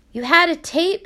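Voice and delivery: urgent, almost out of breath; breathless. A narrator's reading. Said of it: "she repeated breathlessly".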